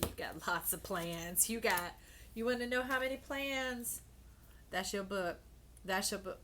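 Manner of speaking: ordinary talk, nothing marked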